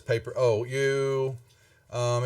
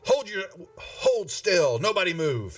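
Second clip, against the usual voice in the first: commanding shout; 7 semitones higher than usual. Acted. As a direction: make everyone stop dead with it. Guards storm in, order freeze.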